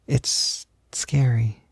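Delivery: slow, breathy whisper; soft whisper. Acted. To a nervous friend trying makeup, a slow whisper. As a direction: whisper it gently, like a secret.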